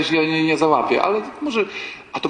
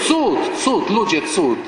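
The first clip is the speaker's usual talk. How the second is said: higher, a touch of mock wonder; hammed-up astonishment; mock amazed cry; 5 semitones higher, 3 dB louder, slower than usual. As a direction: proclaim it as a fake miracle. Mocking miracle of girls singing.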